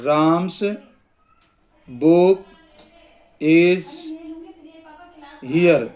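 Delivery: one word at a time, long pauses; slow dictation. Shown as a demonstration. Teacher writing out a possession example, slow dictation. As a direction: dictate slowly, leaving time to write.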